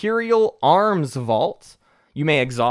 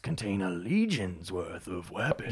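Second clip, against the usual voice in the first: dying warlock voice; 5 semitones lower, 4 dB quieter than usual. Acted. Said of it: "You're doing the dying warlock voice again".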